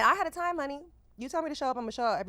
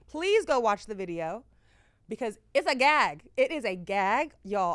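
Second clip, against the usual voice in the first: louder, punchy hype for the video; excited hype; 8 dB louder than usual. Real, not acted. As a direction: sell it to the listeners with energy. Hyping the video to listeners.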